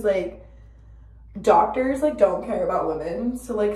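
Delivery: usual speaking voice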